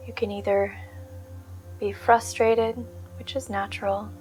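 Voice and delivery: soft, unhurried meditation voice with long pauses; guided meditation. Real, not acted. Meditation teacher settling listeners onto the breath, calm guided-meditation voice. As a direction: gently guide them into stillness, leaving space between phrases.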